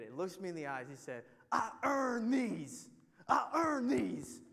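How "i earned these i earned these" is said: loud, high, strained slow yell; imitated menacing boast. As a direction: stretch it out as a hammed-up, forceful cry. Imitates menacing stranger showing tattoo.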